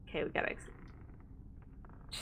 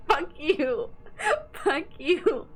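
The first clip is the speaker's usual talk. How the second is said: higher, breaking into laughter; amused mock outrage, pitched up; laughing curse; 6 semitones higher, 3 dB louder, slower than usual. Real, not acted. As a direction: curse him through helpless laughs. Recalls boyfriend's teasing, laughing.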